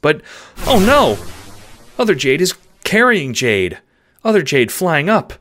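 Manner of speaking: high, urgent simple phrases; alarmed dog. Acted. Dog watching Jade taken skyward, panicked. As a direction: blurt it out in rising alarm.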